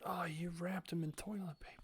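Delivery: hushed whisper to herself. Real, not acted. Gamer noticing the bird wrapped up, whispering. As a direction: breathe it out softly. Quiet aside at the screen.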